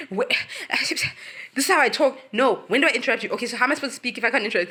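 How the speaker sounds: high-pitched, hammed-up defensiveness; mock indignant protest. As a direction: imitate someone protesting over being told off. Mimics Mildred protesting to Tiff.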